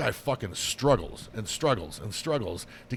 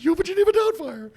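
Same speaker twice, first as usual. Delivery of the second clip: much higher, louder falsetto granny impression; Mrs Doubtfire voice; 10 dB louder than usual. Joking with his co-host, he does a high, loud falsetto Mrs Doubtfire. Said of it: "Everybody's missus Doubtfire".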